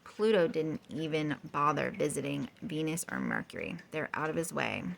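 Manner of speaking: ordinary delivery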